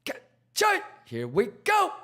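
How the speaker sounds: high pitched hype yell; hype shout. Acted. Hype streamer catchphrase shout.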